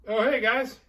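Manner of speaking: high, loud, casual hello; unbothered girl greeting her rescuers; breezy high voice. Retold or imitated. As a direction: chirp it like nothing happened. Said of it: "but she's like".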